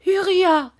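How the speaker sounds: high, loud call of a name; mother's cry. A narrator's reading. Mother crying out to her son, high and loud. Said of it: "cria sa mère".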